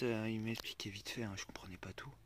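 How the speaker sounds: hushed whisper by the water; chuchoté. Real, not acted. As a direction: whisper it under your breath. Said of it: "Je parle pas très fort".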